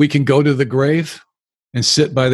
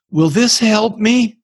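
tearful plea; 8 semitones higher, slower than usual. Retold or imitated. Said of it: "with tears in his eyes".

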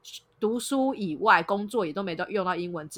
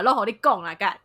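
Taiwanese; 6 dB louder than usual. Retold or imitated. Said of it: "就讲台语".

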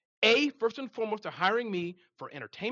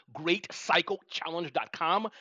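slow and clear; slower than usual. Shown as a demonstration. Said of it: "so I'm gonna have to say that again".

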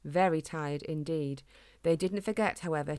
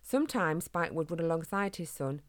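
plain reading voice

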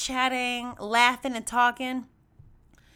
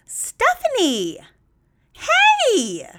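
much higher and louder, a drawn-out happy call of her name; a stranger spots her in the crowd and calls out with delight; joyful greeting; 14 semitones higher, 10 dB louder, much slower than usual. Retold or imitated. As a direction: greet her like a long-lost friend across the bar.

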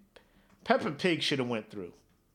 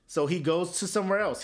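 normal talking voice

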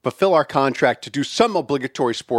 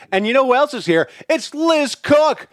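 announcer intro; 10 semitones higher, 3 dB louder than usual. Acted. Introducing the guest.

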